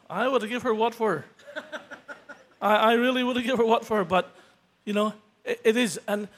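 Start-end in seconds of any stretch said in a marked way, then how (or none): 0.1-4.0 laughing. Real, not acted. Laughing at his old temper.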